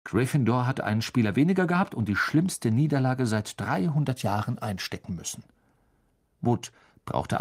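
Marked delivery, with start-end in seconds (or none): none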